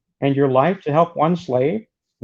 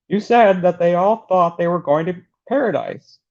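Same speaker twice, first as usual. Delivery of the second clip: laughing through the words, higher; can barely read it out for laughing; 5 semitones higher than usual. Real, not acted. Breaks up laughing while reading.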